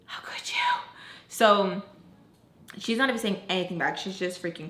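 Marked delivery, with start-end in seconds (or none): 0.0-0.5 unvoiced, hushed accusation; whispered reproach. Retold reproach, said without voice.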